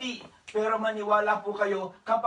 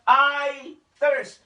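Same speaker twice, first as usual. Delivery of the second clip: louder, higher I thirst in a changed, strained voice; parched cry; 5 semitones higher, 9 dB louder than usual. Retold or imitated. Preacher voicing Jesus again, a more parched, strained cry. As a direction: let the thirst crack through. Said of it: "Sabi niya".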